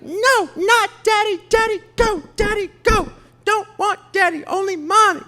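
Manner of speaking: put-on very high, loud, whiny four-year-old wailing; whiny toddler. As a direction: mimic a toddler refusing daddy. Dad voicing toddler's tantrum.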